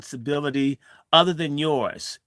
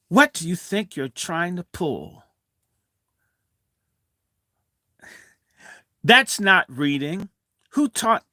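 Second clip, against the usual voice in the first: retold angry teacher; 6 semitones higher, 4 dB louder than usual. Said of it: "she gets angry and says".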